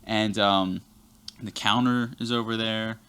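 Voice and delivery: usual speaking voice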